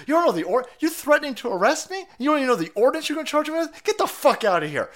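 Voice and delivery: mock outrage, high and fast; lightly imitating the restaurant owner telling off the police; retold indignant yelling. Restaurant owner ordering police out, high mock indignant rant. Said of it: "She goes".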